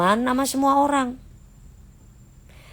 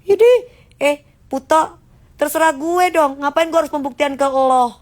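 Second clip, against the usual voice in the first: defiant retort; 4 semitones higher, 4 dB louder than usual. Real, not acted.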